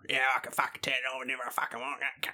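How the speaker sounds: put-on mumbled broad Australian accent. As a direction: slur it in broad Aussie. Performs broad Australian on cue.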